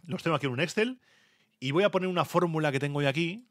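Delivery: ordinary delivery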